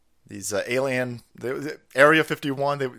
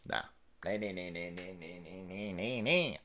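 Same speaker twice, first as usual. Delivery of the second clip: low, much softer fading nahs; dismissive nahs; 10 semitones lower, 15 dB quieter than usual. Real, not acted. Dismissing the left, low and fading. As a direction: wave it off under your breath.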